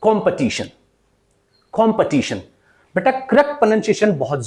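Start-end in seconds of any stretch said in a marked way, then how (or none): 0.0-2.4 "competition" as kom-puh-TI-shun, falling; correct pronunciation demo. Shown as a demonstration. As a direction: schwa second syllable in each "competition". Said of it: "it is".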